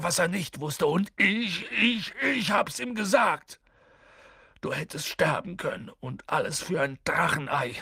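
a guilt-stricken gamekeeper berating himself; remorseful Hagrid. Acted. Hagrid blaming himself at Harry's bedside, high and tearful.

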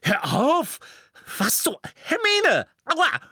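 high, loud yelps between blows; squealing under a beating; pained protest. A narrator's reading. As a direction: yelp for her to stop. Ron protests under Hermine's blows.

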